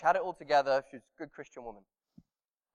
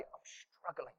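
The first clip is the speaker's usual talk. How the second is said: hushed confession; 18 dB quieter, slower than usual. Retold or imitated. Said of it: "very difficult to say".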